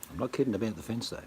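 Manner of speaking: ordinary talk, nothing marked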